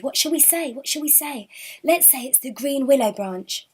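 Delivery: plain reading voice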